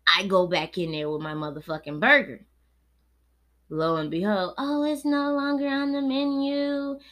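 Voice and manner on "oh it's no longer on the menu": higher, sing-song cashier voice; mocking cashier. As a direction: mimic her casual excuse. Mimicking the cashier's excuse.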